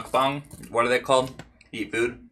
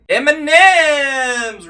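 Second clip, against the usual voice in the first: excited announcement; 10 dB louder, much slower than usual. Pretend. Hyping up the candy.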